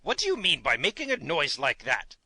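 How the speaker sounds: understated indignant king's voice; mildly angry king. A narrator's reading. King scolding the hen for her noise, mildly angry. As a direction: scold in character without really shouting. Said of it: "shouted the King angrily".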